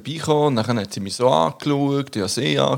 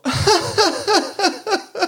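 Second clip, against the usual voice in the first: high, unsure little laugh, imitating the woman; awkward laugh. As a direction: mimic an embarrassed giggle. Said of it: "so ein unangenehmes Lachen". (nachgemachtes unangenehmes Lachen)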